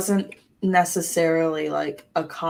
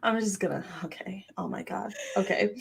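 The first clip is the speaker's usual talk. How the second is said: usual speaking voice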